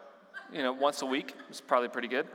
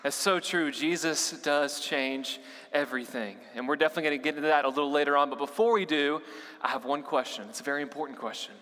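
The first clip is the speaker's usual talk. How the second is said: ordinary talk, nothing marked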